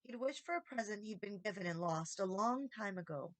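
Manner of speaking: plain reading voice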